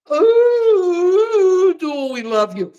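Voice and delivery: high loud ooh then lightly mocking; mock gushing. Mocking MSNBC hosts gushing with a high ooh. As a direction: gush like a fawning host. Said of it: "M S N B C going".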